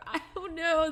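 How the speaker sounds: laughing. Real, not acted. Laughing at own drawing.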